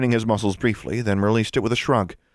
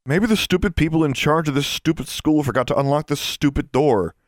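higher-pitched, scornful griping; disgruntled girl; 6 semitones higher than usual. Acted. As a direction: complain with contempt, leaning on each insult. Voices the sullen fat girl.

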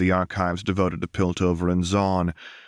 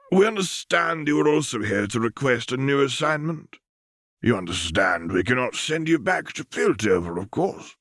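higher, formal voice of a presiding counselor; stern council elder; 5 semitones higher than usual. A narrator's reading. Council addresses operative Lux, formal and cool.

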